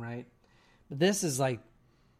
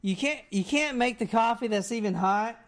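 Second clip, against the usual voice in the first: high, louder mock berating; retold complaining; 8 semitones higher, 3 dB louder than usual. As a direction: scold her like a hammed-up ungrateful husband. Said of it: "And then he complains".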